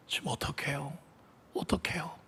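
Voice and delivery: desperate quiet prayer. Acted. Mother silently begging the Lord what to do. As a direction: murmur a panicked prayer. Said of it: "순간 주님께 기도했대요".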